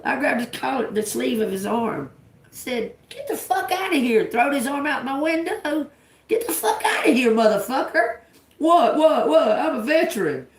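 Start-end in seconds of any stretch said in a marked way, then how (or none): 3.1-4.2 high-pitched re-enacted outrage; retold angry order. Driver throwing man's arm out, acted anger. 6.3-8.2 high-pitched re-enacted telling-off; retold angry order. Driver ordering man from car window, acted anger. 8.6-10.5 high, loud imitation of indignant protest; mocked veteran. Veteran protesting to driver, mocking indignation.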